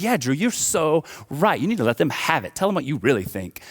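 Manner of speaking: high, loud, swooping pretend egging-on; hammed-up supportive cheerleading, pitch leaping on the stressed words; gushing mock agreement. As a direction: hype up a friend's complaint with exaggerated agreement. Imagined brother hyping up his griping.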